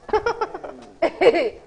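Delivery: words breaking into high-pitched laughter; laughing. Real, not acted. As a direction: say it while cracking up, last word drawn out high. Breaks up laughing at gentle politics.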